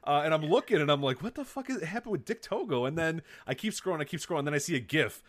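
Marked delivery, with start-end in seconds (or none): 1.2-2.8 high, softened voice of disbelief; incredulous bewilderment. Retold or imitated. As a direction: re-enact being totally baffled by the news. Re-enacting shocked reaction to tweets.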